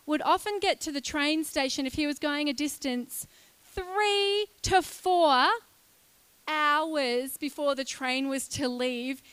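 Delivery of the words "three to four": "three to four" drawn out and higher; slow, stretched "three to four". Real, not acted. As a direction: linger on "three to four" to make it land. Stressing how early he arrived.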